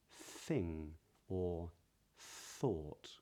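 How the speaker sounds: "thing" and "thought" with a soft, airy, lengthened TH; touch the teeth lightly on "thing" and "thought". Shown as a demonstration. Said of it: "So if I say, for example".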